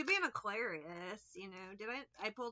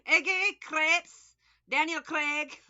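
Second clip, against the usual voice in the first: put-on loud, higher Scottish-accent Iggy, Crepes, Daniel Craig; mock Scottish accent; 6 semitones higher, 11 dB louder, much slower than usual. Running through her whole Scottish repertoire, mock accent. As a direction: rattle them off in a hammed-up Scottish burr. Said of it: "That's all I got".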